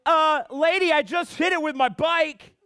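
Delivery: high, loud, exasperated comeback; indignant retort. Retold or imitated. Crashed rider to the lady worried about a mailbox, indignant.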